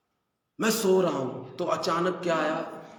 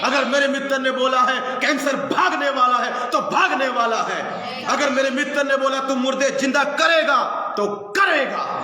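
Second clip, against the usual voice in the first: loud fervent preaching, pitch raised; preaching at full force; 7 semitones higher, 3 dB louder than usual. Acted. Preacher rousing his church, full-force preaching. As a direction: proclaim it like a revival preacher.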